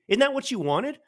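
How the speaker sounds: ordinary talk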